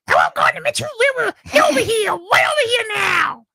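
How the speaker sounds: very high, squeaky shouting dog voice; little French bulldog voice. Acted. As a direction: call out like a tiny excited dog. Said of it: "I like to do the little French bulldog voice".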